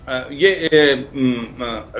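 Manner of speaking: echoing line audio; heavy echo on the call; cave echo. Real, not acted. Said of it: "इको आ रहा है".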